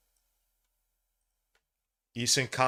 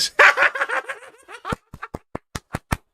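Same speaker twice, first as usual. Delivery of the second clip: loud delighted high laugh; high joyful laugh; 4 dB louder than usual. Real, not acted. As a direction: burst out laughing in joy. Laughs at MVP joy.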